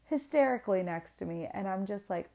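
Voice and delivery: usual speaking voice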